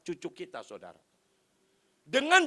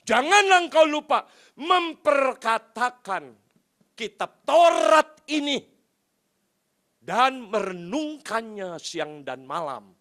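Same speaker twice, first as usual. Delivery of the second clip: declaimed scripture; 8 dB louder, slower than usual. Retold or imitated. God's counsel to Joshua, slow solemn proclamation. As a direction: proclaim it phrase by phrase, loud at first.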